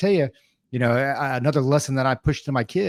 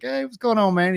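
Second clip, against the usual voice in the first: cheery greeting; 10 semitones higher than usual. Retold or imitated. Greeting voiced in retold scene.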